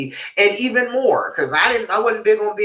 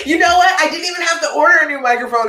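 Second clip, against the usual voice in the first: excited; 9 semitones higher, 6 dB louder, faster than usual. Real, not acted. Gleeful reveal about the microphone.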